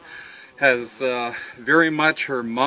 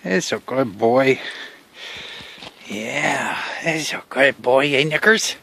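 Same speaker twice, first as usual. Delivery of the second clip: doggy praise; 6 dB louder than usual. Real, not acted. Praising the dog directly.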